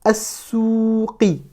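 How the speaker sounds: "As-souqi" slid straight from a onto a doubled s; slow "As-souqi" with no l sound; silent lam. Shown as a demonstration. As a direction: leave out the l and hold the ou in "As-souqi". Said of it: "on ne va pas prononcer le lam".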